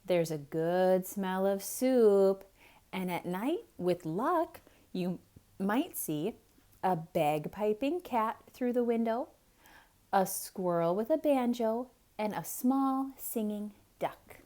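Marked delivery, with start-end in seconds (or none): none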